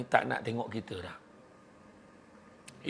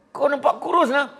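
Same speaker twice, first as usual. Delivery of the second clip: high compliment; 11 semitones higher, 4 dB louder than usual. Acted. Tenth friend compliment.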